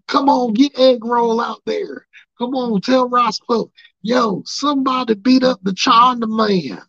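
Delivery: high, loud heckling crowd voice; high loud mock heckler; rowdy fan. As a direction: yell like an ignorant fan in the stands. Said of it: "what do you hear the fans saying".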